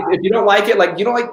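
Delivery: ordinary delivery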